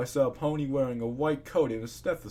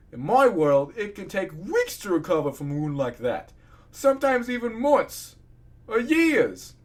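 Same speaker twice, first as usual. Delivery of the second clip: loud incredulous rant, rising higher; yelling; 8 semitones higher, 7 dB louder than usual. A narrator's reading. Patient argues how long wounds take to heal, yelling. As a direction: keep yelling, climbing in pitch toward the end. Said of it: "Sorry for yelling at you".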